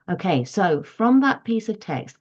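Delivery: normal talking voice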